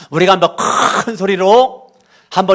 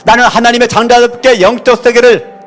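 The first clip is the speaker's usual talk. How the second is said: commanding loud prayer; 6 semitones higher, 7 dB louder than usual. Real, not acted. Pastor loudly rebuking evil spirits before the church. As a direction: drive out the spirits with full force.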